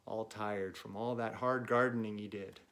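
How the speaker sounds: plain reading voice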